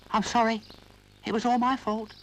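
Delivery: put-on high little boy voice, speaking up; Noddy owning up bravely. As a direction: own up bravely, small but firm. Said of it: "spoke up bravely".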